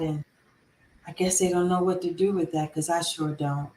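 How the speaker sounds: low, soft, subdued confession; grieving father. Retold or imitated. Widowed father explaining his wife just died, low and hushed. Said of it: "And he looked up".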